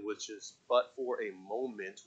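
normal talking voice

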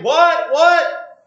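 loud, high puzzled calling back; confused shout. Retold or imitated. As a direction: shout back, not hearing them. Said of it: "he's saying".

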